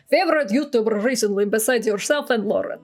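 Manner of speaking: put-on Russian accent. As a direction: ask it in a mock Russian accent. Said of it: "pull out the Russian accent".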